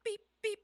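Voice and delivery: high, loud imitated bleep; censor beep. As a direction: bleep out the swearing in a high pitch. Self-censoring a rider's cursing.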